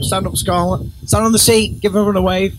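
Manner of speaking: high-pitched call across the room. Real, not acted. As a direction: coax a guest up for a wave. Calling to a girl across room.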